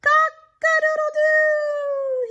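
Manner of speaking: high loud stretched crow; proud rooster crow. Acted. Happy rooster finally crowing right. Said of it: "he crowed".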